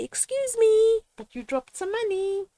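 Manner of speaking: high, polite attention-getting call; modelling how to tell a man he dropped money, high and polite; polite stranger. Shown as a demonstration.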